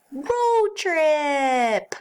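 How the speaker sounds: high, loud excited whoop; excited cry. Acted. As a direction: shout it like a kid heading off on a trip. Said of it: "cried Pete".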